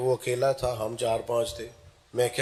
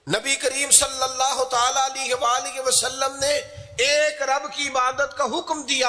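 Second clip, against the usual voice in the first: preaching that climbs higher and stretches key words; impassioned preaching; 9 semitones higher than usual. Real, not acted. Preacher urging the congregation to be strong, high fervent declamation. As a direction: declaim it with mounting fervour.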